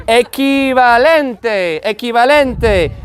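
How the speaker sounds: equivalente very slow, ki sound for qu; teacher slowly models equivalente; slow Spanish model. Shown as a demonstration. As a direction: stretch out equivalente, qu as k. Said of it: "equi becomes equi".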